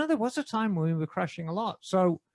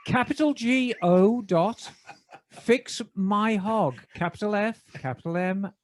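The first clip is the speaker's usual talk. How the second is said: measured BBC announcer reading an ad. Acted. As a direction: deliver the sponsor read in your best BBC British. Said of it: "in my best B B C voice".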